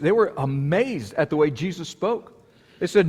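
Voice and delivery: usual speaking voice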